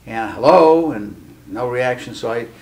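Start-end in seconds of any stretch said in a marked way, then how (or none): 0.3-0.9 louder hello. Retold or imitated. Calling again after no reaction.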